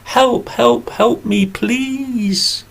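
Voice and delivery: high, small pleading bee voice; bee crying for help. Acted. Trapped bee under the glass begging for help, high and pleading. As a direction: squeak out a desperate plea from under the glass. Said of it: "and the bee cried".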